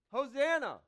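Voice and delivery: loud, high ringing call of Hosanna; joyful shout. Pretend. Preacher showing kids how to shout, loud and high. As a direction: cry it out like a crowd of children. Children cry out in worship.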